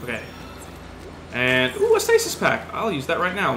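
ordinary talk

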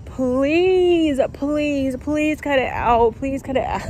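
high, begging please; exasperated pleading. Real, not acted. Begging about the stolen delivery, high exasperated pleading. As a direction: plead with the universe to stop.

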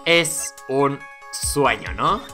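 very slow, word by word; dazed disbelief. Acted. Lawyer answering the judge in disbelief, slow dazed delivery. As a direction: spell it out slowly, stunned.

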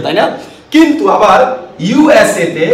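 normal talking voice

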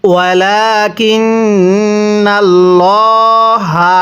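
ওয়ালাকিন্নাল্লাহা recited slowly with আল্লাহ said full and heavy; heavy Allah. Shown as a demonstration. Teacher showing the heavy আল্লাহ, slow recitation. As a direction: round and thicken আল্লাহ in ওয়ালাকিন্নাল্লাহা. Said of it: "তাহলে কিভাবে পড়ব?".

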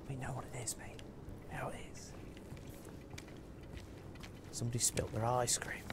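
soft unvoiced whisper. Streamer whispering to chat about spilled ice cream, hushed whisper. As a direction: hush it like a secret.

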